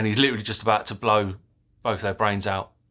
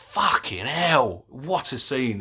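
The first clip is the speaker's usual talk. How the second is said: drawn-out, higher, amazed curse; awed exclamation; 4 semitones higher, slower than usual. Real, not acted. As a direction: let it slip out in slow awe. Awestruck at stunt work.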